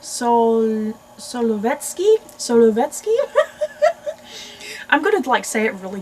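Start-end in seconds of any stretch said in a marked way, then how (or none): 1.2-3.2 Solovetsky as a loud, rising question; questioning stab at saying Solovetsky; unsure pronunciation attempt. Real, not acted. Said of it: "Let me see if I can pronounce it". 3.2-4.8 very high-pitched giggle; long, high burst of giggling; embarrassed laugh. Real, not acted. Laughs at failed pronunciation.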